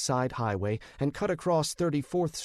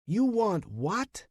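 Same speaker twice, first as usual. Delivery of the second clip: high, startled yell; rising disbelieving yell; 8 semitones higher, slower than usual. A narrator's reading. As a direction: shout it in disbelief. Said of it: "he yelled".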